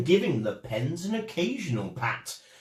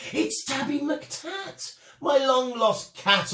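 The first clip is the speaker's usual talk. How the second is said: much higher and slow with overjoyed wonder; ecstatically glad; 10 semitones higher than usual. A narrator's reading. Fred finding his long-lost cat again, overjoyed exclaim. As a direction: cry out in delight at a lost friend found. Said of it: "old Fred was ecstatically glad".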